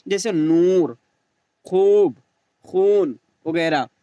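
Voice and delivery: नूर, खूब, खून with the ऊ stretched slowly and loud; long oo. Shown as a demonstration. Teacher repeating the stretched waw emphatically. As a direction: hold the ऊ long. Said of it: "उसे खींच कर पढ़ा जाए".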